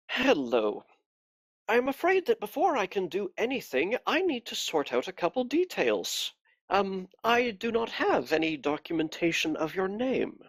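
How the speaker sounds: a cold, pious interrogator; cold pious accusing. Acted. You can hear the Inquisitor's voice.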